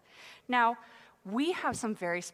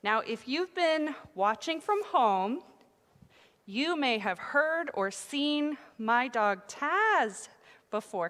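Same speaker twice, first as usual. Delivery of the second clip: normal talking voice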